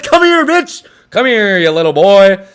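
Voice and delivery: mock taunting yell. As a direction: shout it like a player taunting his defender. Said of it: "and he says".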